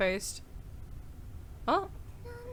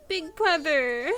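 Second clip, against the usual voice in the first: tearful; 3 semitones higher, much slower than usual. Acted. Character tearful call.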